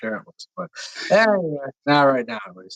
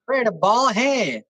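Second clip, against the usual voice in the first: put-on high, lilting country girl voice; country girl accent; 7 semitones higher than usual. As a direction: play it as a high-pitched Southern belle. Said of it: "Harsh's country girl accent is on point".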